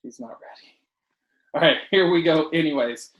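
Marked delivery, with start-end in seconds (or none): 0.0-0.8 hushed teasing aside; whispering. Real, not acted. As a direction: whisper it behind her back. Teasing aside about his daughter.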